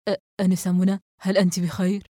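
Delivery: a touch of confusion, stumbling on the first word; hesitant, mildly rattled check-in; slightly flustered. A narrator's reading. Investigator phones the girl to check on her, slightly flustered. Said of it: "بنبرة مرتبكة بعض الشيء".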